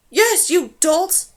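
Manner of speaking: exasperated villain's shout; snarling. A narrator's reading. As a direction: snarl at incompetent underlings. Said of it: "snarled Rakosh".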